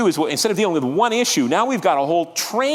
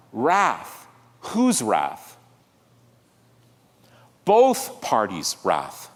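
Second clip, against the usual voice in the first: usual speaking voice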